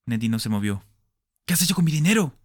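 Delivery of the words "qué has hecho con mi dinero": high, loud furious roar. Acted. Smith roars at Nettie over his money, loud and high. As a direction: bellow it down at her. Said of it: "rugió Smith".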